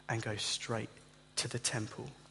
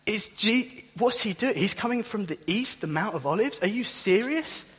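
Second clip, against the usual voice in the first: much higher and a bit louder, stunned disbelief; incredulous surprise; 7 semitones higher, 3 dB louder than usual. Retold or imitated. Crowd seeing Jesus come from the east, high and incredulous. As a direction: squeal out the shock of the crowd. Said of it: "And people are like".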